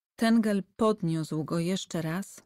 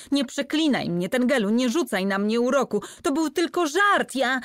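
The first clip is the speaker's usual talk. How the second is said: louder, rising terrified begging; howling plea; 6 semitones higher, 5 dB louder than usual. A narrator's reading. Heming begs Tengel not to curse him, terrified. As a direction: beg for your life, voice climbing. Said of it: "wył".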